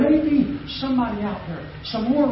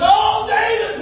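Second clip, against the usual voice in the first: pleading cry; 13 semitones higher, 4 dB louder, slower than usual. Retold or imitated. Abigail pleads with David.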